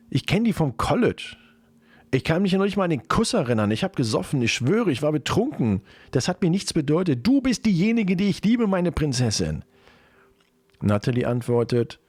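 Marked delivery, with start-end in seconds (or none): 0.1-9.6 put-on high, urgent excuses from a caught boyfriend; pleading boyfriend. Cheating boyfriend begs girlfriend to believe him, high-pitched pleading. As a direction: protest and plead, pitched well up.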